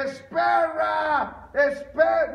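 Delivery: high, drawn-out cry to a runner; long, loud mock shout; shouted joke call. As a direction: call out after someone fleeing. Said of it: "el mexicano le dice".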